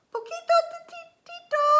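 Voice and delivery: very high, loud, squeaky outburst; exclaiming. A narrator's reading. Space kitten recognizes a friend, high excited cry. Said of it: "exclaimed Scapito".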